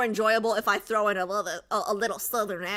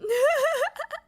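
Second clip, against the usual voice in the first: squeaky burst of laughter; high giggle; 5 dB louder than usual. Real, not acted. Streamer teased by chat, high giggle.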